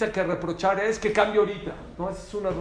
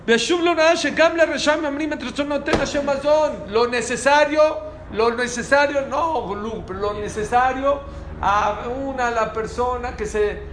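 loud, high declamation of a sacred quote; raised-voice sermon delivery; full-force preaching; 7 semitones higher, 8 dB louder, slower than usual. Acted. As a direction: hammer it out to the congregation, voice raised.

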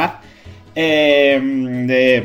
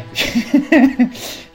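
burst of laughter; 9 semitones higher than usual. Real, not acted. Laughs at own impression.

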